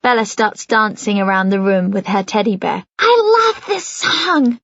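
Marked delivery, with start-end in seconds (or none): 3.0-4.6 put-on high, slow, breathless little girl. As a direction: gasp it out, breathless and happy. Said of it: "she says to me breathlessly".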